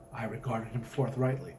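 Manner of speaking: ordinary delivery, nothing marked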